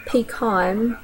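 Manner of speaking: "pecan" as "puh-KAHN"; Southern "pecan". Shown as a demonstration. Speaker demos the Southern "pecan" as "puh-KAHN". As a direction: say "pecan" with a broad AH. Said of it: "second will be Southern version".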